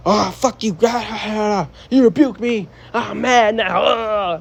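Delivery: mock a man pouting after rebuke; mocking a sulky fool. Preacher mimics a rebuked fool throwing a tantrum, mock whine.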